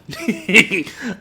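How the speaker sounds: short high burst of laughter. Real, not acted. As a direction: laugh it out, giving up. Laughing at himself.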